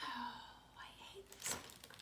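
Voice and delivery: very soft, whispered grumble; mock dismay. Reader hates this book, whispered mock grumble. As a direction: breathe out a defeated complaint.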